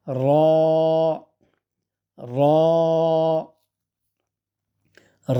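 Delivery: র rounded, with a light tongue-tip tremble; tongue to the upper tooth ridge, tremble lightly on র; lightly trilled র. Shown as a demonstration. Teacher modelling for students, র lightly trilled and rounded. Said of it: "হালকা কাঁপুনি সহ উচ্চারণ হবে".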